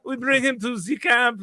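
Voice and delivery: a hint of a German accent, proud; lightly put-on German accent, gloating; hammed-up German villain accent; mock German accent. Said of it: "demanding my German accent".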